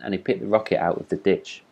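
usual speaking voice

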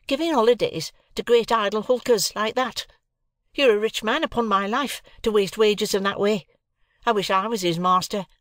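high-pitched nagging rant; angry scolding. A narrator's reading. Sister scolds Joe for giving holidays to hulkers, angry. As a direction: rail at him, pitched high throughout.

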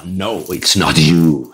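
low, drawn-out mock verdict; slow, deep-voiced rejection, playing the speaker; hammed-up low, slow announcement; imitating big-stage speaker. Retold speaker rejects him on stage.

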